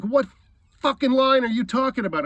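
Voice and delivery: loud, high, indignant retort; angry disbelief. Retold or imitated. Confronting a man over cutting in line, angry disbelief. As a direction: re-enact your outraged challenge, voice jumping up.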